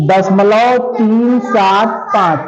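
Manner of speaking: दशमलव तीन सात पाँच said slowly, each digit separate; slow, careful digit-by-digit reading. Shown as a demonstration. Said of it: "तीन सौ पचहत्तर नहीं पढ़ेंगे".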